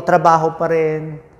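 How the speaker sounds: usual speaking voice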